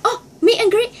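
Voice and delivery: high, loud, delighted question; re-enacted eager realisation; excited recognition. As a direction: pounce on it with sudden excitement.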